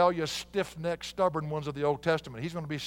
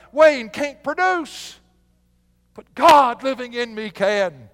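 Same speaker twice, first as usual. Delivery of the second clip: louder and higher, slowing on the last words; preaching at full force; 7 semitones higher, 9 dB louder, slower than usual. Real, not acted. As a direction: preach it out to the congregation, building up. Preaching climax.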